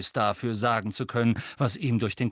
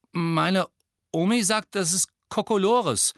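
put-on hesitant boy; 8 semitones higher, 5 dB louder than usual. A narrator's reading. Shy Neville speaks up.

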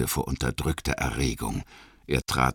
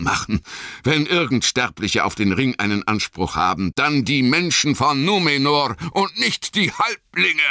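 Boromir ranting, building to a high demand; aufgebrachter Boromir; 8 semitones higher than usual. A narrator's reading. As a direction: rage on, then demand it fiercely. (wütend, zum fordernden Ausruf gesteigert)

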